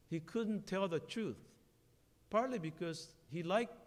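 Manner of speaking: ordinary delivery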